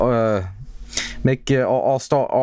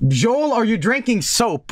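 Joel, are you drinking soap? pitched up high in disbelief; incredulous high read; 9 semitones higher than usual. Real, not acted. Streamer rereads a chat comment, high and incredulous. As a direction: read it like you can't believe it.

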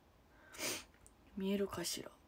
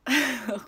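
louder, higher chuckle; short laugh; 4 semitones higher, 6 dB louder than usual. Real, not acted. Streamer reading guesses about the visitor, short laugh. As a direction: break into a quick laugh.

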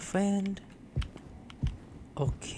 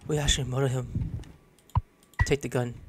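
ordinary talk, nothing marked

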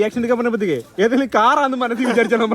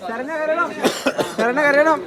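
teasing call-out; 7 semitones higher than usual. Real, not acted. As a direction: egg them on, calling across the room. Teasing bride's family to cry.

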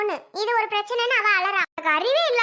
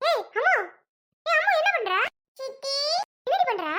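scolding aunt; 5 semitones higher than usual. Acted. Aunt catches girl and scolds her.

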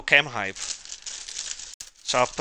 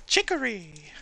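playful sing-song; 10 semitones higher than usual. Acted. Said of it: "I just think it's a funny word".

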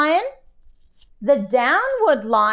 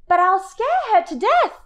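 put-on worried child; 8 semitones higher, faster than usual. Child pupil protesting.

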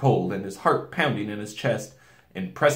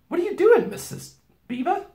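put-on high-pitched questioning girl; exclaiming Susan; 5 dB louder than usual. A narrator's reading. Girl questioning the packing, high exclamation.

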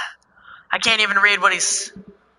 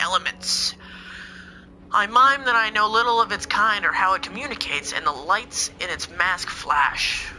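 even, reading-aloud narration; lightly dramatic narration; story narration; 5 dB quieter than usual. Acted. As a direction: read the encounter text like a storybook. Reads the game's encounter text aloud.

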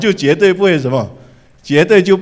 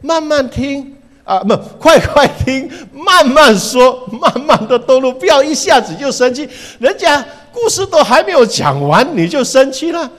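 a lightly voiced, higher, coaxing elder, with a laugh; gentle advice from James; 9 semitones higher, 5 dB louder than usual. Preacher voicing James urging the church, higher and coaxing. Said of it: "雅各告诉我们说".

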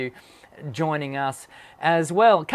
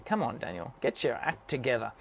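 muttered to self; 6 semitones lower, 9 dB quieter than usual. Real, not acted. Muttering to himself while clicking.

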